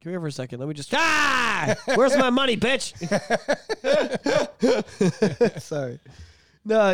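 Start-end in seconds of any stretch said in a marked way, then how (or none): 0.9-2.9 mock thug threat. 3.0-5.6 laughing at his own bit; high laugh. Real, not acted.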